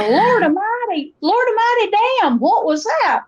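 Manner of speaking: high-pitched Appalachian country outcry; Appalachian exclamation. Shown as a demonstration. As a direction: exclaim in astonishment with a thick mountain accent. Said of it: "I could use phrases like".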